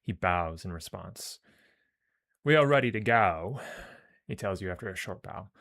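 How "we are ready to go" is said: loud Cockney warrior, meant as Aussie; Australian attempt slipping into Cockney; Cockney accent. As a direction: announce it loudly in rough Cockney. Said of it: "I'm fucking doing Cockney for him".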